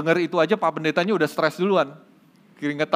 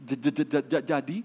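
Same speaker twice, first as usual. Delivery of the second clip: halting stutter, repeating every first sound; a shy stutterer struggles to get the words out; stuttering man; 4 semitones higher than usual. Acted. Nervous stutterer talks to the pastor, stuttering hard. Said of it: "orang gagap ini bilang".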